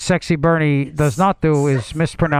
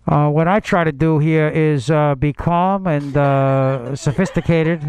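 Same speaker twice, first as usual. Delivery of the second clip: Sexy Bernie. Pretend. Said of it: "Sexy Bernie".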